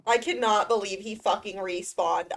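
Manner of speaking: ordinary delivery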